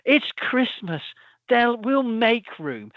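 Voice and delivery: a jolly Scottish shop worker voice; mock Scottish accent. Supermarket worker in the advert reassuring the giant, mock Scottish accent. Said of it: "oh, Scottish accent, goes".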